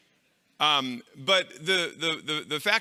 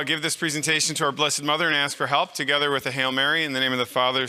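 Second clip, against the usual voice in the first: normal talking voice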